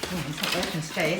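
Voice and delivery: ordinary talk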